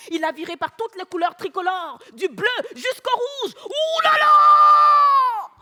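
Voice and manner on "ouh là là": high, loud outraged shouting, drawn out; blowing his top. Acted. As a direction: explode as a betrayed husband. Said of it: "Il a pété les plombs".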